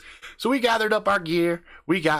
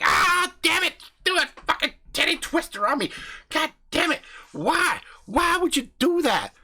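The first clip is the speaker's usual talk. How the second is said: pained outcry; 12 semitones higher than usual. Retold or imitated. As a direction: yell as if pinched hard. Tit twisted, crying out.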